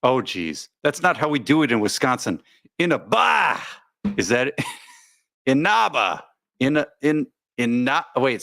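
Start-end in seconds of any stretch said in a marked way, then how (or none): 2.8-3.6 "Inaba" as in-uh-BAH, higher at the end; misplaced syllable stress. Shown as a demonstration. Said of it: "emphasis on the second syllable". 5.4-6.2 "Inaba" as in-NAH-buh, drawn out; stress on second syllable. Shown as a demonstration. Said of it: "emphasis on the second syllable".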